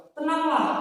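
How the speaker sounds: high, loud call of Tenanglah; Jesus calling out. Acted. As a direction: call Tenanglah out over the storm. Jesus voiced calling to disciples. (seruan keras Tenanglah)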